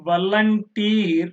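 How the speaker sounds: slow volunteer with a long, stressed teer; last-syllable stress. Shown as a demonstration. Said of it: "where you have to put the". (volunteer నెమ్మదిగా, teer పై ఒత్తిడి)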